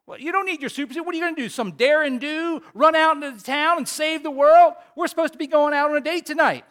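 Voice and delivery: high, loud exasperated scolding; yelling wife. Retold or imitated. Wife yelling back at Frozone about their date, high and loud. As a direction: snap back at him, fed up. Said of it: "the wife yells back".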